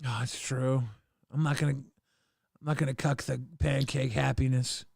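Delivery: soft, low murmur; quiet aside. Real, not acted. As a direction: say it under your breath. Soft aside while she eats.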